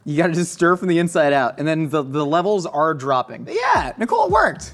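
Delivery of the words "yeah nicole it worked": high-pitched holler across the room; calling out. Real, not acted. Host calls colleague that it worked, high holler. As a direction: call to someone off-camera, pitched way up.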